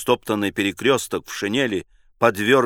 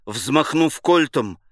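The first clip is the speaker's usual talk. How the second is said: ordinary delivery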